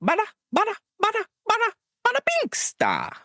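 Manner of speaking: very high cartoon Italian patter; hammed-up Italian accent. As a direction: squeak out a mock Italian accent. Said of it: "Give me your best Italian".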